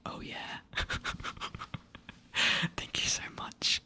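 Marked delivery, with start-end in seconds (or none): none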